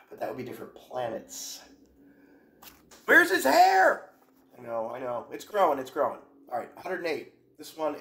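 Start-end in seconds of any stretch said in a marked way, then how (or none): 3.1-4.1 much higher, louder, slow astonished cry; surprised exclamation. Real, not acted. Reacting to a cartoon picture, loud astonished squeal. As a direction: shriek it out in disbelief.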